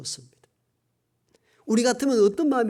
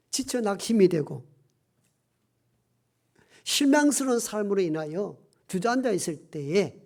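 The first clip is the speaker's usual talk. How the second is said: ordinary delivery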